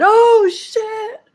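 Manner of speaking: high, stunned drawn-out oath; shocked exclamation. Retold or imitated. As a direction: imitate a friend's stunned reaction. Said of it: "Mohsen said".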